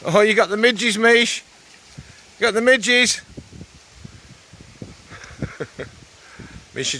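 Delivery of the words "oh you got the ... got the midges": high, loud teasing call; calling to dog. Real, not acted. Man calling to his dog snapping at midges, high loud call. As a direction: sing out to her from a distance.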